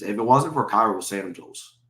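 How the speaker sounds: normal talking voice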